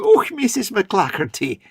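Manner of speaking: slow, high, sweet Scottish lilt; gentle Scottish lilt. Retold or imitated. Wife's polite greeting to a neighbour, gentle Highland lilt. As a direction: croon it like a polite Highland lady. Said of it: "she goes from".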